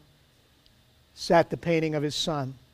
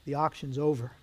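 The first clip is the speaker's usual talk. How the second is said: soft, lower closing words; subdued announcement; 4 semitones lower, 9 dB quieter than usual. A narrator's reading. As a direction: close it quietly, with finality. Auctioneer quietly ends the auction.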